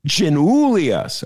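Giannoulias slowly and firmly as zhee-NOO-lee-us; drawn-out Giannoulias, NOO stressed; Giannoulias, four syllables, falling at the end; correct pronunciation. Shown as a demonstration. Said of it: "Get it right".

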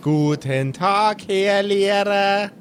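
slow sing-song schoolkid greeting; drawn-out chorus of pupils; childlike class greeting. Acted. Class greeting teacher in unison.

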